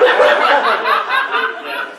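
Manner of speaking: high, loud burst of laughter; real big laugh. Real, not acted. Group laughs at teller.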